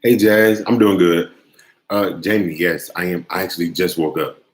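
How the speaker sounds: ordinary talk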